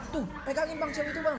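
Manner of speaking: high, urgent acted call; agitated taxi driver. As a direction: hand over a struggling captive. Supir menyerahkan cewek yang berontak. (berseru panik)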